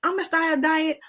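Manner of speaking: high, chirpy self-talk about dieting; playfully mimicking the dieter's promise; mock resolution. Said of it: "you say".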